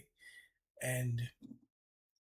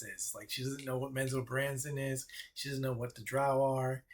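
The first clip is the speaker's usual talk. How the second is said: scheming goddess; 3 semitones higher than usual. Acted. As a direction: dangle the deal like a trap. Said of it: "I was like".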